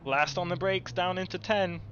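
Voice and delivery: ordinary talk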